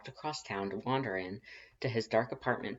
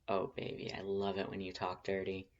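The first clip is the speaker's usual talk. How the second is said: flirty whisper; 5 dB quieter than usual. Acted. Flirty teasing reply.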